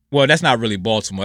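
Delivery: normal talking voice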